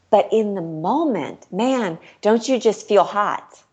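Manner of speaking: ordinary delivery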